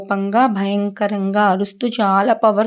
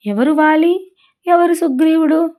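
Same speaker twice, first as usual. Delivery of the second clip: higher, bewildered asking of who is who; puzzled questions; 8 semitones higher than usual. Acted. Storyteller to children, acting out Rama's confusion, puzzled and higher. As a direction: sound baffled, pitch up on each question. Rama cannot tell brothers apart.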